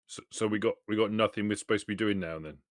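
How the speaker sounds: ordinary talk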